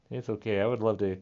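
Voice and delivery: ordinary talk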